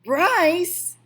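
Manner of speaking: high, loud shout of a name. A narrator's reading. Old woman shouts for the boy, loud and high. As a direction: yell for the boy. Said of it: "shouted the old woman".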